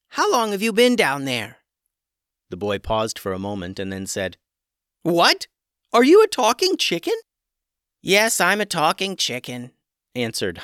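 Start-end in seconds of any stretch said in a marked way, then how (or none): 0.1-1.6 higher, curious chicken voice; talking chicken asking the boy; chicken's voice. A narrator's reading. 5.0-7.1 put-on much higher amazed boy's voice; boy amazed at a talking chicken; astonished boy. 8.0-9.7 higher, curious chicken voice; talking chicken asking the boy; chicken's voice. A narrator's reading.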